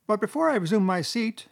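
high-pitched speechifying Chief Weasel; high squeaky voice. A narrator's reading. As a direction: address the banquet in a high squeak. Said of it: "began in a high, squeaky voice".

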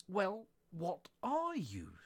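high, loud, drawn-out demand; demanding challenge. Acted. Pigeon challenges Alice to explain herself, demanding. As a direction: press for an answer, stretching the words.

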